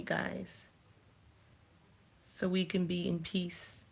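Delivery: whispered, soft and slow; real soft whisper; hushed whisper. Real, not acted. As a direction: confide it to the viewers under your breath. Aside to viewers, hushed.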